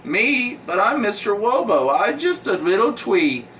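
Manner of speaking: squeaky-cute pleading with lisped w sounds; little cartoon tree. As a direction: play an innocent little tree, saying w for l and r. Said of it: "this tree's like".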